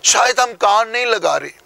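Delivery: ordinary talk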